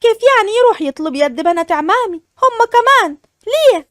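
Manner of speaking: stunned disbelief building to a high ليه; shocked. Acted. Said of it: "ليلى بصدمة". (صدمة بصوت عالٍ وسريع يتصاعد)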